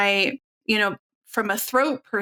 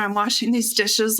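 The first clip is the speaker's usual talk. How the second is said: ordinary talk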